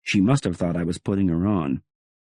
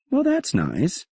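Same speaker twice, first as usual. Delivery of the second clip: nonchalant; 11 semitones higher, slower than usual. Acted. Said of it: "still loose".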